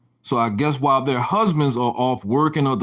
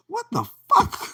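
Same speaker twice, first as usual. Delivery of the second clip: shocked disbelief. Real, not acted. Disbelief at restraining order.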